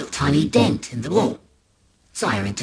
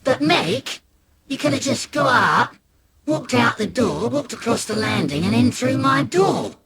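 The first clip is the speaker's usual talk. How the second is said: put-on high, rising kid's voice; raised-pitch sibling exasperation; incredulous brother; 8 semitones higher than usual. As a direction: point out the obvious with mounting disbelief. Brother mocks the pointless effort.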